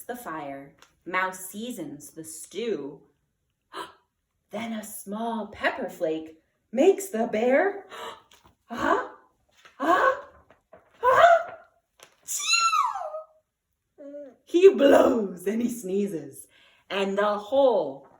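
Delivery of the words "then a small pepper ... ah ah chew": ah-ah-ah climbing ever higher and louder, bursting into chew; big comic sneeze climbing to a burst; building sneeze. A narrator's reading. Bear sneezing in story.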